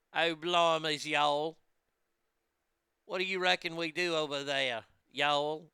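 lightly put-on British accent with a southern drawl; mock Cockney sliding into a Southern drawl; English-southern accent mashup. Shown as a demonstration. Host demonstrating a silly English accent with southern drawl. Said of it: "English accent with a southern drawl".

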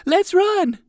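high, loud yell; frightened shout. Acted. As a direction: scream it and bolt. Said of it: "shouted both boys together".

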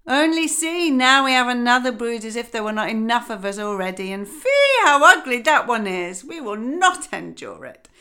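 loud, high jeering ducks; said aloud. Acted. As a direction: sneer at the newcomer loudly. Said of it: "said aloud".

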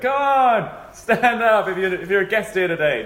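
hammed-up preacher coaxing guests; mock preacher urging.

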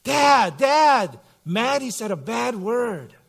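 put-on high, loud call from the back seat of a kid; child shouting. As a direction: call up to Dad like an excited little girl. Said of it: "one of the girls shouts up".

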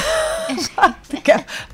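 high, breathy nervous giggle; a real nervous giggle. Real, not acted. Nervous laughter about the topic.

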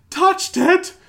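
shrieking high, loud outrage; hammed-up apoplectic squeal; mock outrage. Gump raging at Jack over the unicorn, shrieking high. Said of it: "he's going totally apeshit".

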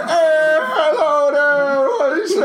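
high, loud, drawn-out Irish party impression; Irish accent. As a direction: holler a hammed-up Irish greeting. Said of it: "Ben does a great Irish accent".

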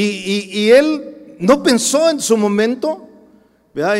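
loud, high preaching to the congregation; forceful preaching. Acted. Preacher to congregation, loud and forceful.

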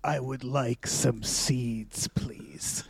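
slow, low, breathy whispered parrot voice; hushed, slow monster-parrot begging for seeds; creepy bird whisper. Pretend. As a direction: whisper it like a sinister talking bird. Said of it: "keeps going like".

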